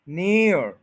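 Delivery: stressed near drill; stressed syllable. Shown as a demonstration. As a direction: punch near. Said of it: "near पे stress है".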